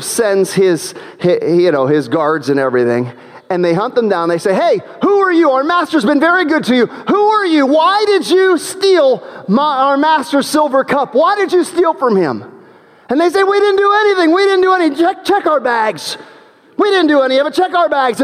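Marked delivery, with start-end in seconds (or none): 4.5-12.4 accusing guards. Acted. 13.4-18.2 pleading denial. Acted. Brothers protesting innocence.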